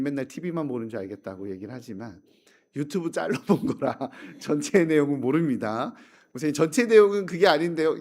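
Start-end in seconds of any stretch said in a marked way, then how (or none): 6.3-7.9 quick, louder mock objection; wife's retort. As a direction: tease the pastor like his wife. Wife objecting to pastor. (아내가 목사님에게 빠르게 반박하듯)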